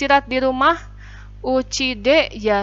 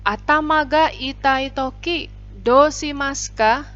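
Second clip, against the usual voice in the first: "atama ga itai toki, dou shimasu ka?" higher and slow, rising on "dou"; model question; 4 semitones higher than usual. Shown as a demonstration. Teacher leads the class drill with a slow question. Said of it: "kita mulai ya sama sensei ya".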